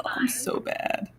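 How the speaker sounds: whispered, slow "I'm so bad"; guilty whisper. Host owns up to class, slow guilty whisper. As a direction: breathe it out like a naughty confession.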